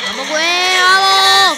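excited cheer. Real, not acted. Cheering himself on mid-race.

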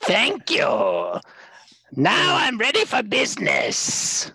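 put-on high-pitched gnarled old hag; Hagacorn hag. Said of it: "transform into my glorious true self, which is my Hagacorn self".

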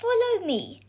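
put-on high-pitched boy's voice; eager boy. A narrator's reading. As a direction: beckon George along as the boy. Boy leads George off.

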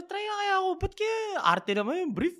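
high-pitched, eager self-talk; excited musing. Retold or imitated. Retelling his shop thought to the host, high and eager. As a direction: talk yourself into buying fancy briefs.

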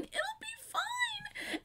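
high, soft, slow, breaking into tears; sobbing quote. Real, not acted. Fan quoting the lyric while starting to cry. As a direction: echo the line as the voice cracks into a sob.

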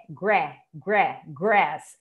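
GRASS drawn out from "gré", open "eh" vowel. Shown as a demonstration. Teacher isolating the A sound in grass, open E pronunciation. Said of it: "o sonzinho aqui do A tá com E".

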